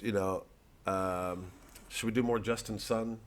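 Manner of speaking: ordinary delivery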